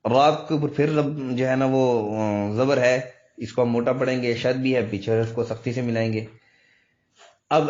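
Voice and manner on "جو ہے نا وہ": normal talking voice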